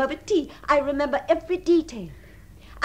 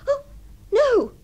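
high, loud cry of protest; sudden loud objection; alarmed refusal; 10 semitones higher, 7 dB louder than usual. Acted. Fending off a lover's advances.

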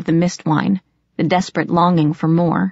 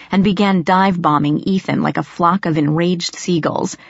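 usual speaking voice

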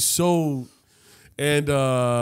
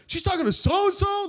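very high incredulous question; hammed-up astonishment, pitched way up; acted disbelief. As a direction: echo the news in shocked falsetto. Reacts to rival's revelation.

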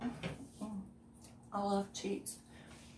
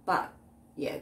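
normal talking voice

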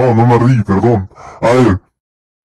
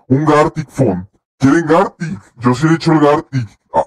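usual speaking voice

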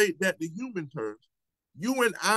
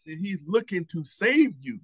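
ordinary talk, nothing marked

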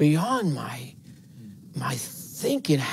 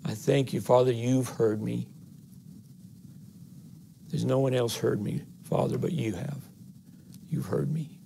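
quieter, tender prayer to the Father; soft intimate prayer; 7 dB quieter than usual. Shown as a demonstration. Preacher imagining Jesus praying, soft and tender. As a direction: say it softly, as a private thanks. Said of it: "I wonder if that's the way he put, said it".

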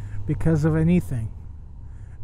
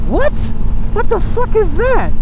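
very high, loud what; shocked disbelief; 13 semitones higher, 6 dB louder than usual. Real, not acted. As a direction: squeal it in total shock. Shocked by the sign.

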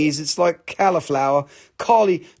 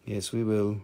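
usual speaking voice

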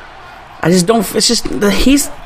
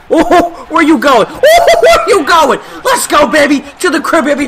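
much higher, louder, laughing as you yell; laughing excited shout; 8 semitones higher, 3 dB louder than usual. Real, not acted. Gamer cheering his runner to the end zone, laughing shouts. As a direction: cheer the runner all the way home.

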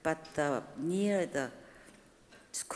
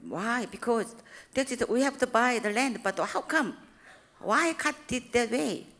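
higher, louder protest to God; indignant complaint; 6 semitones higher, 4 dB louder than usual. Retold or imitated. Preacher retells demanding answers from God, indignant. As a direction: argue your case with heaven.

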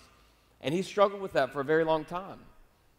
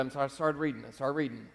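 normal talking voice

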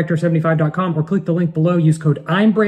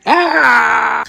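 fake cry; 7 semitones higher, 3 dB louder than usual. Pretend injury play with toddler.

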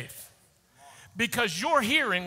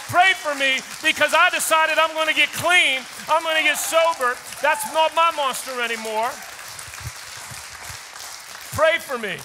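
higher and louder, building to a shout; rising triumphant testimony; 4 semitones higher, 3 dB louder than usual. Retold or imitated. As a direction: preach it with growing victory. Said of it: "How about this shift?".

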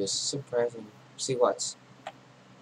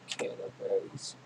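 softer, slow whispered "Candleberries"; whispered to self; 3 semitones lower, 7 dB quieter than usual. Real, not acted. Gamer musing over the item alone, whispering. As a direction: breathe "Candleberries" to yourself. Repeats item name to himself.

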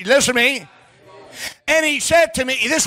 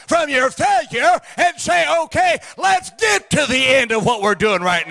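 preaching at full force; 4 semitones higher than usual. Acted. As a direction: preach it hard to the congregation. Preacher building to a shout.

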